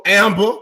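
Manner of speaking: "Amber" drawn as "Ambuh", final r dropped; country pronunciation. Real, not acted. Host reads a listener's name her country way, "Amber" without the r. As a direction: hit "Amber" loud and high, ending in "-buh". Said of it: "that's the way you say it".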